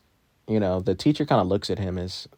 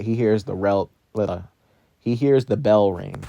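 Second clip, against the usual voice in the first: normal talking voice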